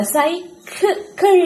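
സൈക്കിൾ stretched high with a laugh; slow laughing demo. Shown as a demonstration. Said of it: "ഇവിടെ കണ്ടോ". (ചിരിയോടെ സൈക്കിൾ നീട്ടി പറയുക)

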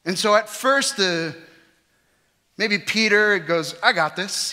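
loud, high preaching; preaching at full force. Acted. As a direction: preach it out to the hall.

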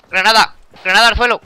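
urgent loud warning; grenade warning yell. Real, not acted. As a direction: shout it to warn the squad. Grenade lands near squad. (grito de aviso de granada)

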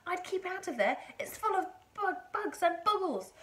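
put-on high, loud boy's warning; called-out warning. A narrator's reading. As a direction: warn her urgently from below. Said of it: "George called out".

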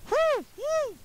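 high hooting owl call. Acted. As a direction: hoot like an owl. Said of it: "an owl come into frame, like, going".

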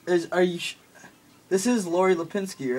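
ordinary talk

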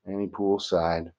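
ordinary delivery

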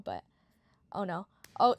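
ordinary talk, nothing marked

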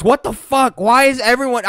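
normal talking voice

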